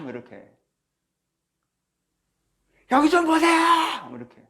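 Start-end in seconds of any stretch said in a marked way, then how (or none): 2.9-4.1 high, drawn-out desperate howl; howling cry. Retold or imitated. Lepers howling to Jesus from afar, desperate loud cry. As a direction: cry out like a wounded animal. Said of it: "거의 짐승처럼 울부짖었을 거라고".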